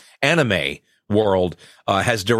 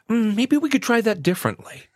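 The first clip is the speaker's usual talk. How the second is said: polite director; 11 semitones higher than usual. Retold or imitated. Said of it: "so professional and so nice".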